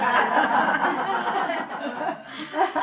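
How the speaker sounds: long loud laughing burst; real, full laugh; hearty laugh. Real, not acted.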